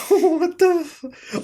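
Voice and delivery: high, slow laughing what the f; laughing disbelief. Real, not acted. Vlogger cracking up at an anti-bubble definition, laughing. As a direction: crack up mid-word, way up high.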